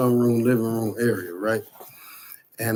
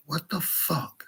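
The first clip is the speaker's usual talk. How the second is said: soft, higher-pitched disbelief; hushed shock; 6 semitones higher, 9 dB quieter than usual. Retold or imitated. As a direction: breathe it out quietly in shock. Retold shock at telepathic answer.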